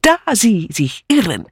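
gleefully prove the inspector wrong; triumphant. A narrator's reading. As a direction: crow it in accented German. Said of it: "rief Mitzi triumphierend".

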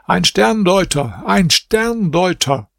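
high, slow, drawn-out public cry; loud call. A narrator's reading. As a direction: call it out across the palace square. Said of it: "rief hier mit lauter Stimme".

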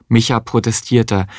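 plain reading voice

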